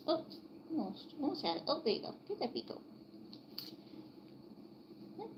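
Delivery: high, soft coaxing voice; soft coaxing pet talk. Real, not acted. Owner coaxing her chick to open its beak, high and soft. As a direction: gently wheedle a stubborn chick.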